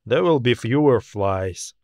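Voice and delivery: plain reading voice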